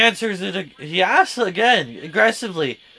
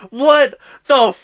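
mock bewildered outcry; 11 semitones higher, 7 dB louder than usual. Listener in bed hearing the fight, bewildered outcry. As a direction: wail each word in exaggerated bafflement. Baffled reaction retold, escalating.

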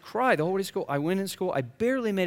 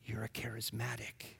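hushed, secretive whisper; real quiet; 6 semitones lower, 14 dB quieter than usual. Retold or imitated. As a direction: whisper it like a shameful diagnosis. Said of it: "he got real quiet".

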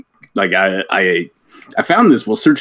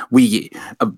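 ordinary talk

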